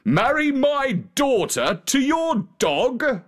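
high, incredulous royal question; scandalised king stretching the words; outraged king. A narrator's reading. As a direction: climb high in pompous disbelief, slowly. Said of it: "The king was outraged".

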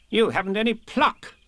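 a bossy rabbit chiding; Rabbit's voice. A narrator's reading. Rabbit scolds Piglet.

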